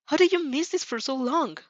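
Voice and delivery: high-pitched excited wonder; giddy. A narrator's reading. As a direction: ask it in eager delight. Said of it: "your shadow giddy voice".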